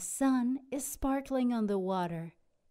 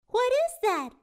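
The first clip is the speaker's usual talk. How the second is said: high, louder first mate voice; Callie asking; 13 semitones higher, 5 dB louder, faster than usual. Acted. Callie spots something coming, high voice. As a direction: ask it in a bright high voice. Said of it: "asks First Mate Callie".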